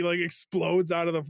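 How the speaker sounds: ordinary talk, nothing marked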